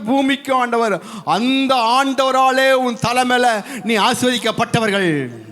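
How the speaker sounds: high, ringing pulpit voice; full-force preaching. Acted. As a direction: proclaim the blessing over the church at full force. Preacher proclaims blessing.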